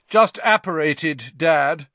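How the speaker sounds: loud, slow, self-important announcement; loudly. Acted. Percy announcing his arrival to his father, loudly. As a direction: proclaim it loudly and slowly to Dad. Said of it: "said Percy loudly".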